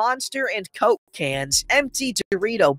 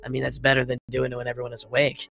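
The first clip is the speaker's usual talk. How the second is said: low, quick, softer aside; offhand aside; 6 semitones lower, 5 dB quieter, much faster than usual. Acted. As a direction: toss it off under the rant, conceding the point. Muttered aside undercutting his rant.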